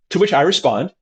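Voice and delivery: usual speaking voice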